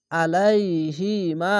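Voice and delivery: very slow drawn-out recitation; Quran recitation. Shown as a demonstration. Teacher showing students the recited word, slowly drawn out. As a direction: stretch each syllable like tajweed. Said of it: "এক আলিফ টেনে পড়বেন".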